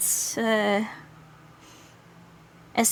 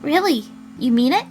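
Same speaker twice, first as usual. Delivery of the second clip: higher, eager questioning; hopeful surprise; 6 semitones higher than usual. Acted. Partner hearing the wish to be closer, hopeful surprise. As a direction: react with surprised hope. Acted hopeful reply to confession.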